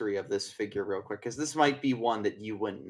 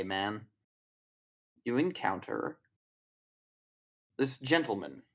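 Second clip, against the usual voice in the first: ordinary talk, nothing marked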